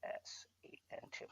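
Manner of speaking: whispered characters while typing; whispered reading. Real, not acted. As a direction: mumble the code under your breath. Typing link, reading under breath.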